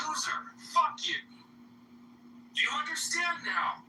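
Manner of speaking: high, yelled demand; furious high yell; angry shout. Real, not acted. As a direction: bark it at him. Shouting insults in a fight.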